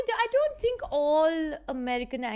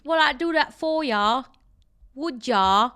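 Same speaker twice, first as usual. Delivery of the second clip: slow, louder mock Essex lilt; slow, louder Essex lad imitation; Essex accent; 7 dB louder, slower than usual. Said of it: "he would say things like".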